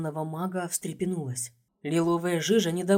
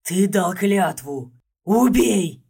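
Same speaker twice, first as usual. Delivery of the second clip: slow, much louder monstrous command; inhuman roar; 7 dB louder, much slower than usual. A narrator's reading. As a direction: roar the order like a beast. Monster demands being killed.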